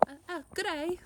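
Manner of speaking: a quick, silly stab at an Australian greeting; hammed-up Australian accent. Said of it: "that's Australian".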